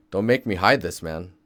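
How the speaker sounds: usual speaking voice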